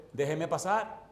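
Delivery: firmer retold demand; pressing request, lifted at the end; insistent repeated request. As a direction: insist on being let through. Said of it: "vuelvo y le digo al hombre".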